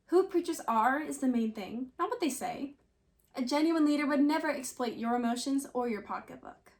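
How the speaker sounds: usual speaking voice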